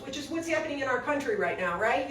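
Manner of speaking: ordinary delivery, nothing marked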